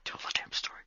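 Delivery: hushed urgent whisper. Acted. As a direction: hiss it under your breath. Urging her in a whisper.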